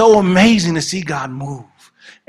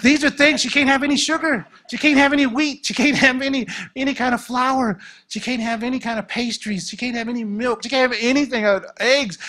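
frantic listing; 7 semitones higher than usual. Retold or imitated. Frantic listing of forbidden foods.

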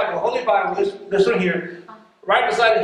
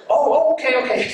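flustered pastor; 6 semitones higher, faster than usual. Retold or imitated. Pastor caught out, retold.

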